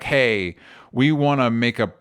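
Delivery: ordinary talk, nothing marked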